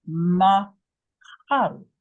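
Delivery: slow, with each sound of machal spelled out; drawn-out syllables. Shown as a demonstration. Teacher showing the word slowly. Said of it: "That's very short".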